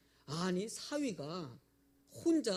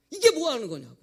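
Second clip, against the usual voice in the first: sudden loud, higher indignant shout; retold angry outburst; 6 semitones higher, 15 dB louder than usual. Mother finds her son cooking alone, furious shout. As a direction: blast it out like an outraged mother. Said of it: "너무나 화가 나가지고".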